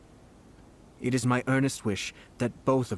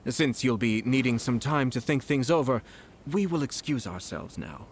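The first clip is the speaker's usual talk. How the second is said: ordinary talk, nothing marked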